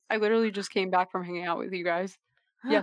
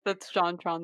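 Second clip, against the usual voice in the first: ordinary delivery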